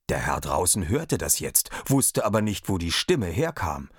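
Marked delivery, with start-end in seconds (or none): none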